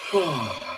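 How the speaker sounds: soft yawning sigh of relief, fading out; real yawn with an exhaled sigh. Real, not acted. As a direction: let the yawn escape quietly. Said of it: "I don't know if you noticed".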